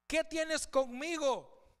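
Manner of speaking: slow, high cry of the possessed man. Acted. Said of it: "exclamó a gran voz".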